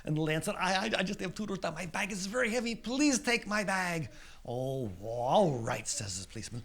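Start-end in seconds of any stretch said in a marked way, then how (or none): 0.6-4.1 high, hurried plea, slowing at the end; stammering, pleading youngster; pleading little kid. Acted. Lance pleads with policeman. 4.5-5.9 slow, drawled-out grudging consent; reluctant policeman giving in; grudging agreement. Acted.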